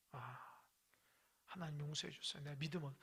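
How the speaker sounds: Sarah asking God's pardon, soft; soft repentant prayer. Retold or imitated. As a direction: murmur a plea for forgiveness.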